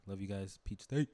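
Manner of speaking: normal talking voice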